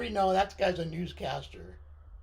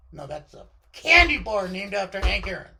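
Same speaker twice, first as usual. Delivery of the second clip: ordinary talk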